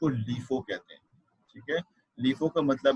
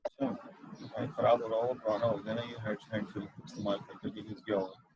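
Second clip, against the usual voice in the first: far from mic; 3 semitones lower, 8 dB quieter than usual. Real, not acted. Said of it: "थोड़ी सी बेहतर है sir, लेकिन पहले ऐसे नहीं".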